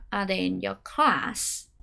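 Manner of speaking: "Are there in your class?" said low, soft and slow, thrown away; unstressed filler. Shown as a demonstration. Teacher shows the unimportant words said low and light. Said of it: "những từ không quan trọng".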